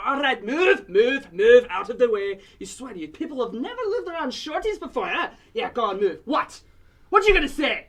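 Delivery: loud, high barking dwarf shoving through; fierce old dwarf. Acted. Dwarf shoving through the crowd, yelling at people. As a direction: bellow at the crowd to clear the way. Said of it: "a stout, old, fierce-looking dwarf is smacking people aside".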